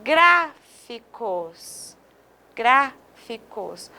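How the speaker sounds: gráficos drawn out very slowly, stress on grá; slow stress demo. Shown as a demonstration. Teacher repeating the word for the class, very slow. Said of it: "Olha essa outra".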